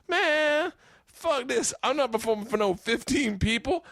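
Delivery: high-pitched, griping old-comic impression; Red Fox. Headliner refusing a tiny audience, disgusted impression. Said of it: "Red Fox grabs the mic".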